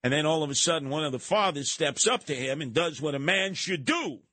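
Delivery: normal talking voice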